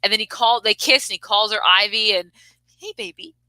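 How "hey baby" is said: high, soft imitation of a come-on; mock flirty coo. Retelling a kiss scene from the movie, mock flirty coo.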